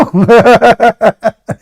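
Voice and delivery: high, mocking laugh; amused laugh. Real, not acted. Debater laughs at the opponent's reply, mocking. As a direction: laugh at his answer.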